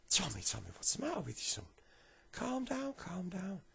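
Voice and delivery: very high, coaxing granddad voice; fussing granddad. Acted. Granddad to crying Tommy, high fussing concern. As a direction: soothe him in a high voice with falling endings.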